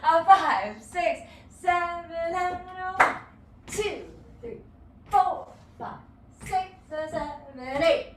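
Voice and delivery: rhythmic teacher count, four punched loud; dance count-in. Shown as a demonstration. Dance teacher counting the class in, loud on four. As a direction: count the class in with a driving beat. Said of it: "That should be you".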